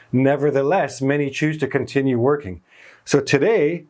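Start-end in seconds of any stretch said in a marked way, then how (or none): none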